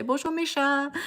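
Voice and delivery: high-pitched French pet greeting; greeting the cats. Shown as a demonstration. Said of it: "I say".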